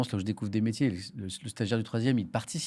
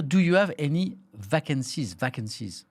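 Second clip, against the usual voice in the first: English in a strong French accent, slowly spelled out; unashamed French-accented English; slower than usual. Shown as a demonstration. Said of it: "en anglais avec mon accent à moi".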